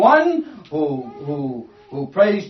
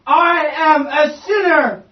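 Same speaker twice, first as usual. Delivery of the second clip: slow, higher and louder plea; crying out for mercy; 7 semitones higher, 6 dB louder, slower than usual. Retold or imitated. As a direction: cry out to God, begging. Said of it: "And he's crying out for mercy".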